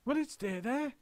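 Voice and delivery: high fretful question; nervous helpers; high worried chorus; worried monkeys. A narrator's reading. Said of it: "they cried".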